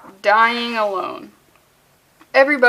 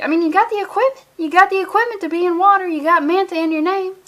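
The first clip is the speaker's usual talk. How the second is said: higher, fast indignant rant; excited rant; 5 semitones higher than usual. Real, not acted. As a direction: argue it, faster and higher.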